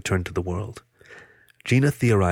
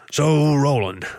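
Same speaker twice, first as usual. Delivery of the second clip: raised, casual opener; offhand character voice, higher than the narration; 6 semitones higher, 4 dB louder, much slower than usual. A narrator's reading. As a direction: casually toss out the name, pitched up. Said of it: "Boris said casually".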